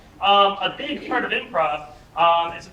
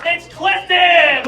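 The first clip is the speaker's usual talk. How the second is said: louder and higher, stretched out like a game-show intro; hype announcer; 8 semitones higher, 9 dB louder, much slower than usual. Acted. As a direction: call the name out to a cheering room. Announcing performers.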